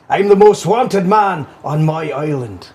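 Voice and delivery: loud, slow mock Scottish brogue; bad film Scot, drawn out; Braveheart Scottish accent.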